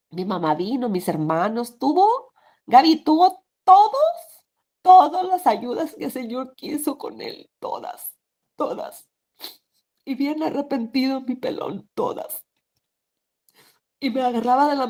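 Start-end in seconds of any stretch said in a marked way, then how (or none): 1.8-7.5 voice rising with emotion. Real, not acted. Emotional peak recalling help. 7.6-14.9 tearful, voice breaking. Real, not acted. Breaking down in tears.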